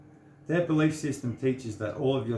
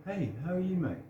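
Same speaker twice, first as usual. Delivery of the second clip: much higher, bright friendly hello; greeting the man beside him on the plane, sunny and high; cheery greeting; 8 semitones higher, 4 dB quieter, faster than usual. Retold or imitated. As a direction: greet the stranger like an old mate.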